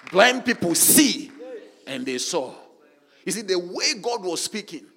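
loud, soaring preacher's cry; preaching at full force. Acted. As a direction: thunder out God's commands to the congregation. Preaching on God's creating word.

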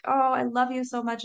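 quick, high gush of love; affectionate pet talk. Retold or imitated. Owner petting her goldendoodle, affectionate gush. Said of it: "I'm petting him and I'm like".

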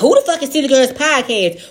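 loud high outraged question; indignant shout. Pretend. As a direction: yell it like a jealous girlfriend. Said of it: "She gonna be like".

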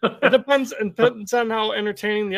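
real laugh bursting through the words. Real, not acted. Laughs while defending his grade.

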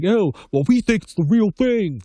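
high, smug cop brushing you off; mocking cop voice. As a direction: play the cop who's already decided you're guilty. Cop voiced in a feared traffic stop.

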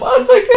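high, loud laughing cry; laughing wail. Real, not acted. Podcaster reacts to sad episode with a high, loud laughing wail. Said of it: "cry shout".

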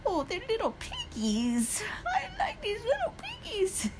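high, soft squeal of adoration; cutesy gushing. Retold or imitated. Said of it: "whenever I like something, I'm like".

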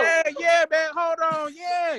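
high, loud yelling hype; excited shout. Real, not acted. Playful scuffle, cheering.

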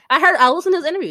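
ordinary delivery

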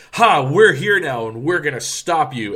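hammed-up "we've got you" bravado; mock villain challenge. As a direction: declare it like a cocky cartoon villain. Acting out villains' bold challenge.

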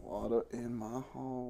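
hammed-up drawled Southern twang; mock Southern accent. Cohost repeating the promo code in a mock Southern accent. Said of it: "where that accent came from".

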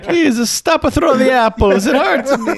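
very high, pleading mock Italian accent. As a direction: beg like a pitiful comic Italian. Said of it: "the Italian accent is approaching".

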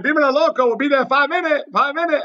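cheerful Dominican dispatcher hustling a ride. Retold or imitated. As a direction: play the car service guy in a Dominican accent. Voicing Dominican car service dispatcher.

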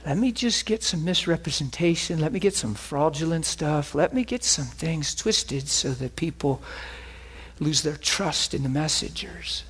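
a hint of a plotting devil, low and hushed; scheming devil. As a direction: plot it under your breath. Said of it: "the devil goes".

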